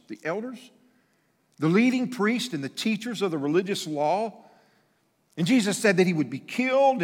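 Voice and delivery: loud, high, driving sermon delivery; fiery pulpit voice; preaching at full force. Acted. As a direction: preach it out to the back pews.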